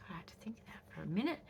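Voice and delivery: soft, fast, whispered confession; whispered aside. Real, not acted. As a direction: whisper it quickly to yourself. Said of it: "whispered aside".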